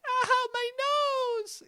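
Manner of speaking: put-on very high, slow, babyish whine; cutesy squeak. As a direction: squeal it like a wounded lover, drawn out. Partner's reaction in cutesy voice.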